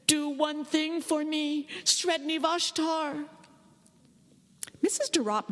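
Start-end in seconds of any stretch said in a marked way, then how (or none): 0.1-3.3 slow, high, hushed plea; boy praying to his hutch god; pleading boy. A narrator's reading. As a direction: beg the ferret god slowly. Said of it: "Conradin's bitter litany went up".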